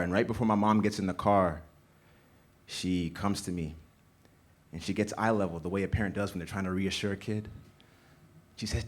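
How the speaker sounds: ordinary talk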